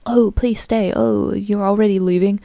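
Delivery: pleading. Acted. Guests to someone leaving the party, pleading. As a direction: imitate someone begging a friend to stay. Voices guests begging her to stay.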